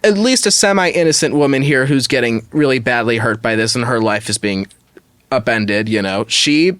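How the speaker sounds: usual speaking voice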